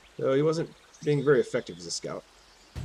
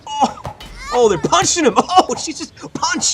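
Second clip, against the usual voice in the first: excited laughing; 13 semitones higher, 5 dB louder than usual. Real, not acted. Watching villagers punch enemy.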